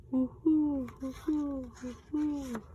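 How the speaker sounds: owl's distant answering hoot; owl echo answering. Acted. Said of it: "An echo came threading its way".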